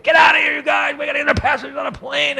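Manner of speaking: high-pitched mock shout of police clearing a crowd; put-on high voice, hustling people away. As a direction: yell it as a harried policeman. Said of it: "I could hear the police".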